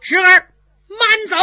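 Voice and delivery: high, loud shout after the rider; loud call from horseback. Acted. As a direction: yell it out to stop him leaving. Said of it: "胡奎在马上高声喝道".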